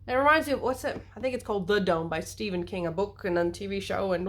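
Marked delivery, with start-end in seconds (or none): none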